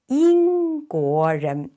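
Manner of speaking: 英国人 said slowly, tones clear; slow model Mandarin. Shown as a demonstration. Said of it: "teacher models the word".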